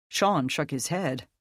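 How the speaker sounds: ordinary delivery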